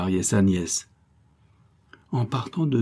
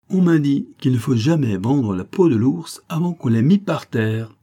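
high, loud mock-grand recitation; comic emphasis; 5 semitones higher, 5 dB louder than usual. A narrator's reading. Niece recites verse at mother and uncle, comic emphasis. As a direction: declaim it with comic pomp. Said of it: "avec une emphase comique".

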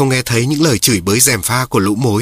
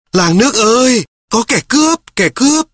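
termites shouting; 11 semitones higher than usual. A narrator's reading. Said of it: "Lũ mối chạy tán loạn la lên". (lũ mối thét lên the thé, hoảng loạn)

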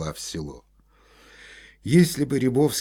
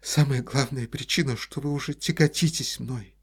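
tearful reproach; 7 semitones higher than usual. A narrator's reading.

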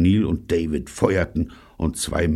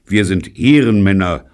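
loud, raised, dignified declaration; dignified lie; 4 semitones higher, 10 dB louder than usual. A narrator's reading. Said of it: "log Robert würdevoll".

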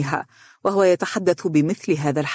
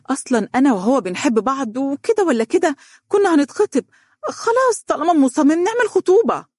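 climbing higher and higher, pleading; rising insistence; 10 semitones higher, 3 dB louder than usual. Acted. Donia insisting to her mother, pitch climbing higher. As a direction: press harder with each phrase.

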